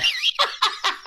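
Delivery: high wheezing laugh; cracking up at grandpa's story. Real, not acted. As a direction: laugh hard at the memory.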